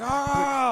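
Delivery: high, loud mock roar; imitated war cry. Imitating the fighter after smelling salts. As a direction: let out a hyped roar. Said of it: "And he was like".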